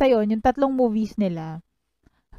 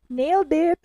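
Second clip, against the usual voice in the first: high, bright mock "Nailed it!"; cheering like a show judge; mock judges' cheer; 7 semitones higher than usual. Host quoting judges' catchphrase, cheering. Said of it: "ang sasabihin nila ay".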